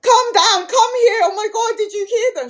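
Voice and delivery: high, loud frightened call for help; scared call. Retold or imitated. Colleague calls her friend down, high and scared. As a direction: scream for the friend to come. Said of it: "she screamed to her friend".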